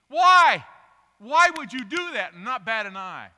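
high, loud, challenging why; preaching shout. Real, not acted. Preacher to congregation, loud challenging shout. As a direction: throw the question hard at them.

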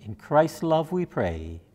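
ordinary talk, nothing marked

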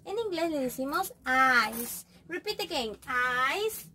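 ordinary delivery

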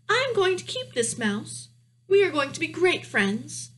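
put-on high-pitched young princess voice; determined princess. Princess tells her father about keeping the mouse, high-voiced.

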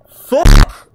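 loud startled yell. Real, not acted. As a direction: shout it out in fright. Jump scare in game.

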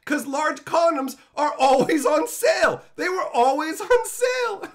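high, loud gleeful emphasis; excited exclaim. Real, not acted. Comic bragging to viewers, loud high glee. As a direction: crow it like a great discovery, loud and high. Excited punchline about condoms.

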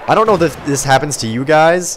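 ordinary delivery

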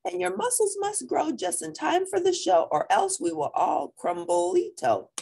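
squeaky character voice pleading; high squeaky dog voice. Acted. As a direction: pitch it way up like a little dog. Character's spoken line in story.

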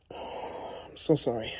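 slow, breathy, all but voiceless apology; tearful near-whisper. Real, not acted. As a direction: barely get the words out, slowly, after a sigh. Apologising after crying, sighs.

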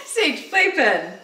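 slow, high command through laughter; laughing command. Real, not acted. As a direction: repeat the order while still laughing. Repeating command while laughing.

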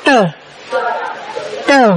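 tờ with curled tongue, released downward; tờ like Vietnamese tr, one at a time; retroflex. Shown as a demonstration. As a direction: curl the tongue up on each tờ. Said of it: "đưa cái lưỡi lên và mình, mình đọc xuống".

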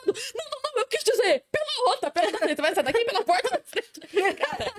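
high-pitched, laughing mock backpedal; flustered through laughter. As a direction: stumble over the correction while cracking up. Retelling friend's slip amid laughter. (se corrigindo atrapalhada, rindo)

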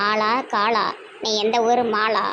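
low, slowing holy-person voice; exorcist. Acted. Exorcist questioning the spirit, low intoning voice. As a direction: intone the rhyme low and slow.

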